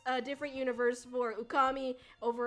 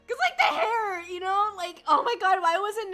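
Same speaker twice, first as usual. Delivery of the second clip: laughing excitedly; 6 semitones higher, 9 dB louder than usual. Real, not acted. Excited laughing about hair.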